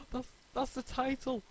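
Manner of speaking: excited gasp. Real, not acted. Surprised gasp at the title.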